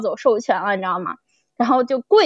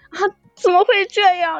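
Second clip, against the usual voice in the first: half-joking tearful whine, higher pitched; mock crying; 6 semitones higher than usual. Listener retells crying to the author, mock tearful whine. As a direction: replay how she wailed to her friend about the sad episode. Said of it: "然后就给他哭".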